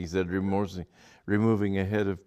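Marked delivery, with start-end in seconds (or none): none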